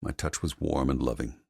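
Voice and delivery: usual speaking voice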